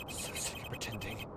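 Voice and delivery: slow, hushed confession to herself; drained, whispered breakdown; broken whisper. Acted. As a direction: let the composure crack in a whisper. Culprit's mask finally cracks.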